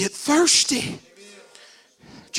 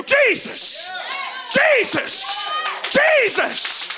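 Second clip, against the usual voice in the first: crying out the name, much higher and louder; shouted name; 13 semitones higher, 4 dB louder, much slower than usual. Shown as a demonstration. Preacher showing the church how to cry out to Jesus, shouting. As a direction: call the name out over and over at full voice. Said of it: "stand in here and go".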